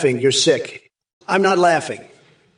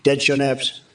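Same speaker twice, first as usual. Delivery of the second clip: ordinary talk, nothing marked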